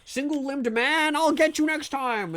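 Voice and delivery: high, drawn-out thwarted cursing; mock villain fury. Tricked enemy cursing the escaping streamer, hammed-up high frustration. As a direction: curse them like a foiled cartoon baddie. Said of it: "they're just like".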